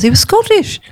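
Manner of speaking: ordinary delivery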